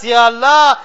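normal talking voice

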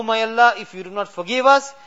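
usual speaking voice

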